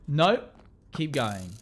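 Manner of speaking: loud. Retold or imitated. Acting out the flow chart.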